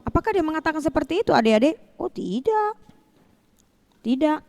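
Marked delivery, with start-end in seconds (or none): none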